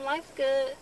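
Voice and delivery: usual speaking voice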